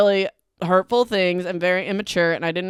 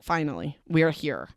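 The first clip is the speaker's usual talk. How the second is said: relieved; 5 semitones lower, 6 dB quieter than usual. Retold or imitated. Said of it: "I was like".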